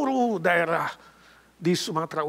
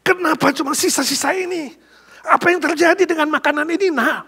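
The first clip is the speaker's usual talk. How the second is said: high, loud angry demand; angry father; 12 semitones higher, 7 dB louder than usual. Acted. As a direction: scold the boy for eating the food. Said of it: "dia kaget dan marah".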